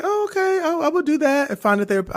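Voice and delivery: high, fast, sing-song agreeable voice; mock eager compliance. Mock eager yes to the doctor, high and quick. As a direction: play along sweetly, all too eager.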